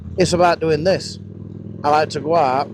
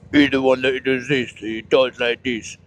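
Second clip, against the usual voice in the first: mock Shane voice; 3 semitones lower than usual. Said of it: "He don't really talk like that".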